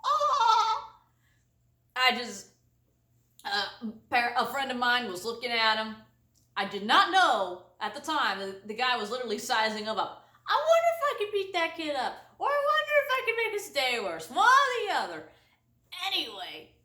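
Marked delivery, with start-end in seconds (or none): 0.0-0.9 high mock wail; mock bawl. Hurt kid on the ground, high mock wail. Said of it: "he was just like". 10.5-15.3 higher, quicker scheming voice; scheming friend. Retold or imitated. Friend eyeing the kid, higher scheming voice.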